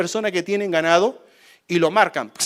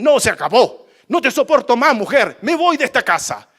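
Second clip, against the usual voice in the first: angry husband; 7 semitones higher, 6 dB louder than usual. Retold or imitated.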